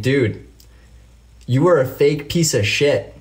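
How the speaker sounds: worked-up, emphatic accusation; riled up. Real, not acted. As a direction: tear into him for being fake. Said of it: "it gets me riled up".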